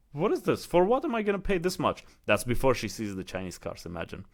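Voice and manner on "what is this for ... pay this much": indignant wife. Retold or imitated. Wife outraged at dealership prices.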